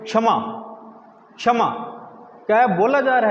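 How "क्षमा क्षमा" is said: क्षमा with the क held inside the throat, not released; क kept in the throat. Shown as a demonstration. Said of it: "मुंह के अंदर ही क बोलेंगे".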